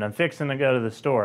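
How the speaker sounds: ordinary delivery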